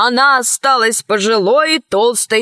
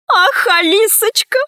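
gushing, high-pitched heroine; high, girlish squeal of greeting; delighted exclamation; 9 semitones higher than usual. A narrator's reading. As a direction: cry out in high-voiced delight. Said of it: "воскликнула Афродита".

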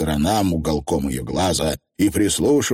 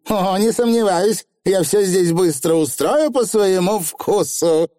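high, drawling, overconfident witch; breezy, high-pitched self-assurance; self-assured young witch; 13 semitones higher than usual. Acted. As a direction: declare it grandly, high and slow. Voices Anagramma, self-assured again.

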